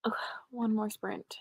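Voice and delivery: unvoiced weary whisper. Real, not acted. Tired streamer whispering to chat about another sprint. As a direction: groan it under your breath.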